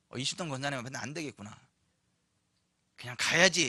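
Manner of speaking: low, soft, resigned aside; defeated demon. Acted. Demons giving up before a believer, low resigned voice. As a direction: back down quietly, as if to yourself.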